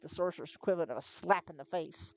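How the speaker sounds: plain reading voice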